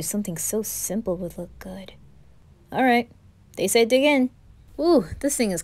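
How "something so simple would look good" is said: whispering. Acted. Quiet aside about the food.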